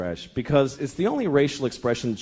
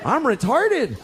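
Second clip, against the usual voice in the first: stunned realization; 7 semitones higher, much slower than usual. Retold or imitated. Boy at camp realizing something, stunned. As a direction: let the realization land in mock shock. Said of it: "I realized".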